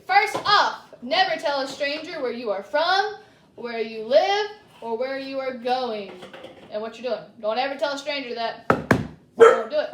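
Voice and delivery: loud, stern lecture to Red; stern loud warning. Acted. Storyteller steps in to warn Red loudly. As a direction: warn her loudly, finger wagging. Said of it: "That was me".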